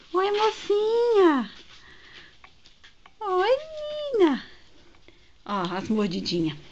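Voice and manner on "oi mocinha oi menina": slow, high cooing greeting. Real, not acted. Owner cooing at her ferret in slow high baby talk. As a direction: coo it slowly, pitched way up.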